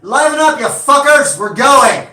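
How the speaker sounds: full-volume, high-pitched scream to the crew; screamed command. Acted. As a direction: scream at the crew to get moving. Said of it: "she screams out".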